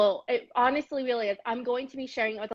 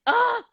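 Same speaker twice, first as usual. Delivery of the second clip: loud, high "ah" of delight; excited exclamation; 11 semitones higher, 8 dB louder than usual. Real, not acted. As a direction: burst out with a high, loud cry. Moved exclamation after praising her story.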